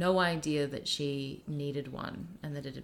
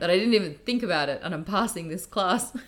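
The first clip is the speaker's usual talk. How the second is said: quoted student, louder and quick, a bit higher; grateful former student; 4 semitones higher, 8 dB louder than usual. Retold or imitated. Student telling his teacher he's passing, eager and grateful. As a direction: rush out the thanks like the kid did. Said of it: "And he was like".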